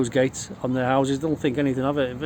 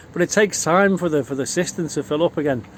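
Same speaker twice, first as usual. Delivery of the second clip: ordinary delivery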